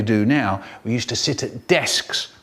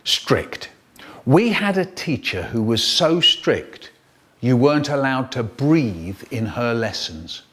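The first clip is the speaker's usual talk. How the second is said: ordinary delivery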